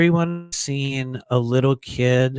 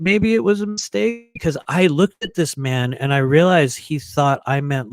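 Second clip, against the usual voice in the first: usual speaking voice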